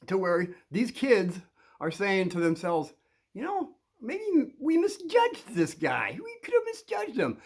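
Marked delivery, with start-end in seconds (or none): 3.3-7.4 very high, drawn-out put-on voice; kids' wondering voices. Bullies wonder to themselves about the new guy, high-voiced musing. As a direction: pitch way up into a squeaky musing kid. Voicing kids' second thoughts.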